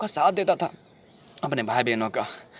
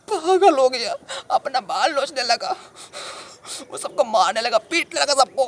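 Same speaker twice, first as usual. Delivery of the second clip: agitated outburst; 8 semitones higher than usual. Acted. Telling sahib the friend went mad, agitated. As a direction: burst out in alarm at the madness.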